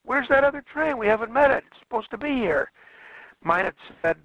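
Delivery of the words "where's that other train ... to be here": higher-pitched, mildly acted alarm; flabbergasted protest. Train crew objecting to being sent ahead, mock flabbergasted. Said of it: "Well, they were flabbergasted".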